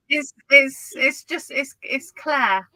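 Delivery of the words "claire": Claire as one syllable, silent E; correct pronunciation. Shown as a demonstration. Co-host answers how the name is pronounced, Claire with silent E. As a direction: say Claire without an ee at the end. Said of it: "How do you pronounce, uh, that name?".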